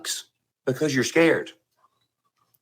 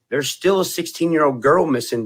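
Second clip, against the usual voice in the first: ordinary talk, nothing marked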